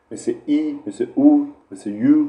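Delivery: carefully sounded-out ای, او, یو; single vowel sounds. Shown as a demonstration. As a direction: clear demo of ای, او, یو as pure English vowels. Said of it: "با یک حرکت ... تلفظ کنید".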